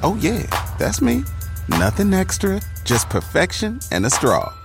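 ordinary talk

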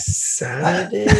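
slow, unsure, drifting fighter voice; dazed guess. Retold or imitated. Recalling the doctor's questions after his knockout, slow dazed guess. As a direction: mimic his own concussed self guessing the day. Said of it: "I'm like".

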